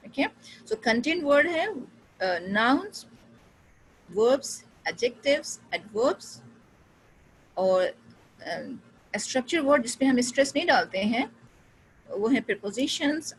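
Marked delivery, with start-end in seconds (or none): none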